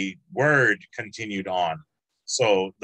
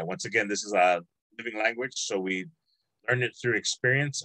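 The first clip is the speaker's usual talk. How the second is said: normal talking voice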